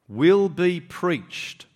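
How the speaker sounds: "will be preached" drawn out very slowly, each word weighted; emphatic scripture reading. Real, not acted. Preacher reading the risen Jesus' commission to congregation, "will be preached" slow and emphatic. Preacher stressing key words of verse.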